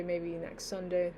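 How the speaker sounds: ordinary talk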